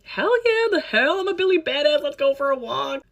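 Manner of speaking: high, loud, hammed-up eager yes; eager hype. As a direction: play her cocky, thrilled younger self. Retelling her eager younger self.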